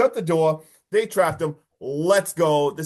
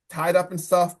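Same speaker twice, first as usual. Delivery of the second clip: normal talking voice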